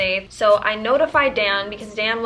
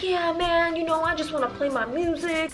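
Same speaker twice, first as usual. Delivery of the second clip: imitating the neighbor; 6 semitones higher than usual. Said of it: "Imagine me saying this".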